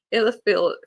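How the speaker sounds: "Aelith feel" said through a laugh; laughing at the stumble on "Aelith"; laughing retry. Real, not acted. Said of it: "like, a lisp or something".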